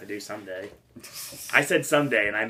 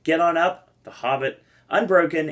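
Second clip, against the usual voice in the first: higher, louder award-show announcer read; 7 semitones higher, 7 dB louder than usual. Acted. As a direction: announce the nominees like the Oscars host. Reads nominees like an announcer.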